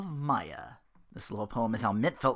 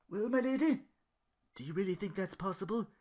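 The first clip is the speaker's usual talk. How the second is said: hopeful Duke; 8 semitones higher than usual. Acted.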